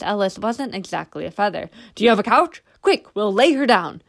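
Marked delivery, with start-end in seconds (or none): 2.0-4.0 urgent order. A narrator's reading. Sergeant urgently demanding a couch.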